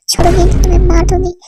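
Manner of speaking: loud angry threat yelled at him; furious shout. Acted. Wife screaming threats at her husband, furious shout.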